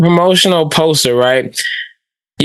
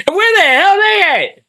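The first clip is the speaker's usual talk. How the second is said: angry; 12 semitones higher than usual. Real, not acted. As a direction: demand it in outrage. Outraged demand.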